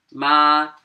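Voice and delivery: MA held high and level; first tone. Shown as a demonstration. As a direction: sustain "ma" loudly on one high pitch. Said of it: "First accent".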